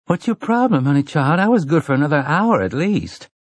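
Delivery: high, teasing grumble of a woman just woken; sassy Susanna. Acted. Woman shaken awake grumbles at her companion, high and sassy.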